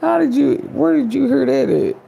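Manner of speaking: high, loud, laughing disbelief; mock shocked. Caught off guard by gossip, mock shocked squeal. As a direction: squeal it out like you can't believe they know. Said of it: "you like".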